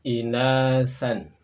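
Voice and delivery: "inasan" slow and loud, syllable by syllable; model Arabic reading. Shown as a demonstration. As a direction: sound out "inasan" clearly. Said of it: "Ini dibaca inasan". (contoh bacaan "inasan" yang jelas)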